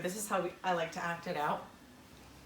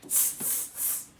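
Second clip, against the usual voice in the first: monkey tsk; 7 dB quieter, slower than usual. Acted. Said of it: "and said".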